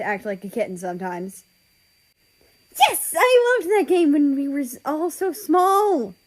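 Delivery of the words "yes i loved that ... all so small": higher, louder gushing little brother, slowing on the end; bubbling over with childish glee; excited. Acted. Ozzy gushing to his brothers about hide and seek, excited. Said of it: "Ozzy says in excitement".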